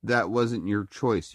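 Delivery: ordinary talk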